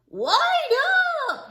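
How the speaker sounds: high desperate holler to wait; calling out. Acted. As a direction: shout after a friend running off. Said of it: "he called".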